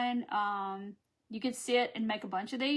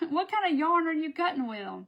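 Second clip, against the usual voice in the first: amazed; 6 semitones higher, 4 dB louder than usual. Real, not acted. Continuing amazed question.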